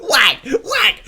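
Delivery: high shouted whack whack; imitated crash sounds. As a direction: bang it out like hitting cars. Imitating cans being hit.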